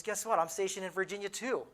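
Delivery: excited news. Retold or imitated. Friend telling him they are both stationed in Virginia, excitedly.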